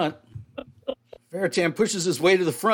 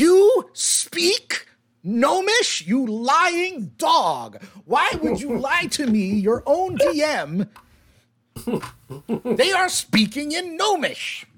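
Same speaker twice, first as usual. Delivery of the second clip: much higher, very slow scandalized disbelief; hammed-up outrage; 8 semitones higher, much slower than usual. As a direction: stretch every word like a betrayed DM. DM mock outrage at player.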